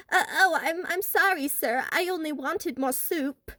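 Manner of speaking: put-on timid British accent, stuttering; fake British accent. A narrator's reading. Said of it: "he faked a British accent and stammered".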